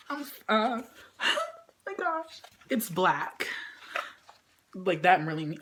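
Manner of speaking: usual speaking voice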